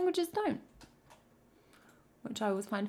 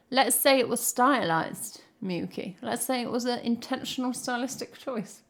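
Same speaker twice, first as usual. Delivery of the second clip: usual speaking voice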